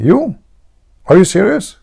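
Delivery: high-pitched incredulous friend; mock disbelief. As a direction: question him in acted amazement, voice jumping up. Doubting friend acted in joke.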